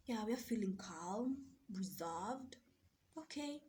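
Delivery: calm reply. Retold or imitated. Calm self-reply after breathing.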